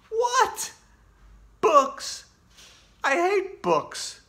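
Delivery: very high, loud shocked protest; outraged. Acted. Kid given books as presents, outraged. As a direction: spit out the disgust at books.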